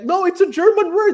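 high-pitched mock protest; know-it-all friend. Know-it-all friend insisting on the German word, high mocking voice. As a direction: imitate a pedant insisting, voice shooting way up. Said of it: "na sobrang know-it-all niya".

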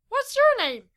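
very high, loud creature voice; piping small-creature question; high-pitched little creature. A narrator's reading. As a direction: squeak the question like a tiny creature. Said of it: "said in a high-pitched voice".

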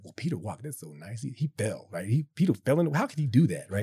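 mocking judgement. Mocks how we judge Peter.

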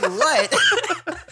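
high laughing mock-innocent what. Husband feigning innocence to his wife, high and laughing. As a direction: play innocent with a squeaky laugh. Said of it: "And I'm like".

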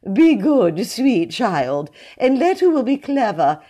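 loud and lofty, pitched well up; pompous high-voiced preacher. A narrator's reading. Mr Jones lectures pale William about lies, high and pompous. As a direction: warn the boy, high and sanctimonious.

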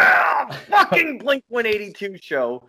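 high, loud outraged yell; exasperated shout. Real, not acted. Echoes partner's outraged shout.

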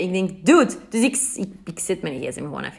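ordinary talk, nothing marked

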